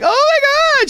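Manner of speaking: very high-pitched mock squeal; imitating excited squeal. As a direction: squeal it like an overexcited film character. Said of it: "She gets so excited".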